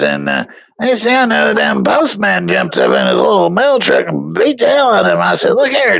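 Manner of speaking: a put-on hillbilly voice recounting the attack; character voice. Voicing the victim in character.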